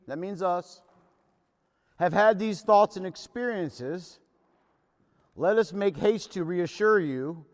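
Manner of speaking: loud, measured reading to the meeting; reading scripture aloud. Acted. Reading the big book aloud.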